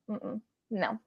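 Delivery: ordinary talk, nothing marked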